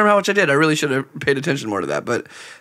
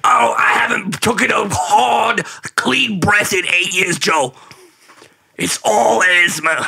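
slow, higher mock wheeze; wheezy whine; 6 semitones higher, slower than usual. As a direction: drag it out like a choking complainer. Wheezy comic complaint.

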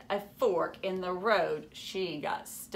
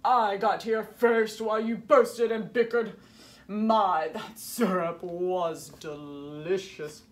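louder, gloating sneer of a villain; 5 dB louder than usual. A narrator's reading. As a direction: savour every word like you stole the prize. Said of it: "crept Baron von Waffle".

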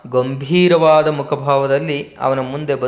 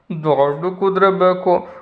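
put-on higher, pouting child's demand; whiny child; 4 semitones higher than usual. Tenali as a child demanding a big horse, pouting. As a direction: sulk it out like a spoilt kid. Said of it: "ಮತ್ತೆ ಮಗುವಿನ ಹಾಗೆ ಬದಲಾದ".

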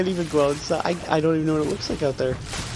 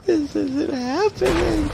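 shocked disbelief; 11 semitones higher, slower than usual. Real, not acted. Monster hits ship, disbelief.

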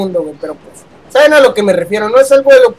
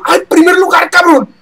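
excited; 7 semitones higher than usual. Real, not acted. Celebrating first place.

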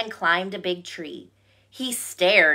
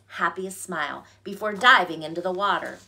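usual speaking voice

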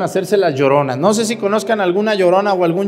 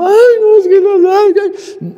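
high, loud hammed-up weeping, slowed; mock sobbing lament; 13 semitones higher, 6 dB louder, slower than usual. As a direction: imitate a widow wailing, loud and high. Widow keeps wailing to priest.

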